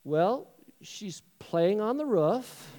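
slow, drawn-out careful reply; hesitant, careful. Acted. Brother gradually breaks news about mom, hesitantly. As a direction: stall and pick each word slowly.

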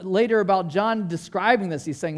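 ordinary talk

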